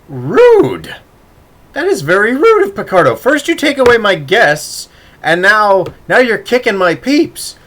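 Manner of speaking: loud, high-pitched outraged protest; mock indignation. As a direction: complain at full volume, hammed-up offence. Streamer protests guests being kicked.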